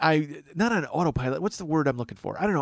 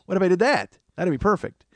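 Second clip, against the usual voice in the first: ordinary delivery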